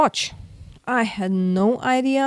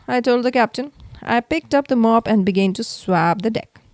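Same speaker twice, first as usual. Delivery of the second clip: plain reading voice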